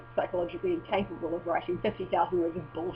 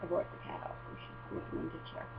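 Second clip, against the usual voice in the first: hushed aside; 12 semitones lower, 14 dB quieter than usual. Real, not acted. Talking low about the cat.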